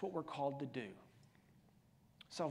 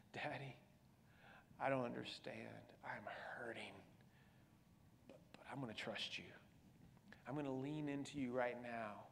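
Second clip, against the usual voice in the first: whispered prayer; 5 semitones lower, 10 dB quieter than usual. Acted. Prays in pain, trusting God.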